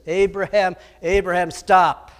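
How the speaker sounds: loud, commanding call from above; voice from heaven. Acted. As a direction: halt him mid-strike. Voice from heaven stops him.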